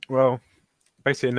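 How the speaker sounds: usual speaking voice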